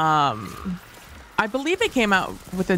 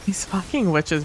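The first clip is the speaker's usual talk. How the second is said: normal talking voice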